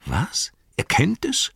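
leaping high into a question; baffled, rising sharply at the end; confused surprise. Acted. Innkeeper to wizard about the king, confused surprise. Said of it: "schaute verwirrt drein".

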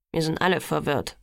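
low, lightly grumbled complaint; grumbling. A narrator's reading. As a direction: grumble it under your breath. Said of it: "grummelte Emmett". (leicht grummelnd, etwas tiefer)

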